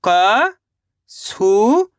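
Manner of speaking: क-सू-र drawn out very slowly, each syllable apart. Shown as a demonstration. Teacher sounding out a word for young pupils, very slow syllables. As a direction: stretch क-सू-र syllable by syllable. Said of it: "मिलके बन जाएगा कसूर".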